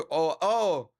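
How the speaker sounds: ordinary talk, nothing marked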